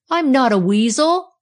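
high, loud protest; indignant yell. A narrator's reading. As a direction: yell it back in denial. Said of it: "he yelled".

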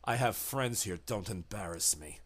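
low, soft, a hint of a cold cultist; quiet command, barely above your own voice; lightly voiced menacing host. Arturo quietly warns the thing in the box, low and cold.

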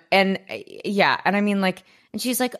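ordinary talk

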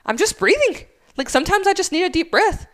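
high-pitched imitation of a defensive man; mock defensive protest. Acted supervisor defending himself.

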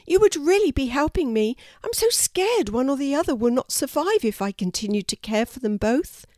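anxious storybook plea; pleading. A narrator's reading. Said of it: "pleaded Elsa".

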